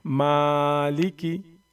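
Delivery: very slow, held vowels; drawn out recitation. Shown as a demonstration. Teacher recites the word slowly again. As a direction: hold Maliki long. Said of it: "थोड़ा सा जल्दी पढ़ने की कोशिश करूँगा".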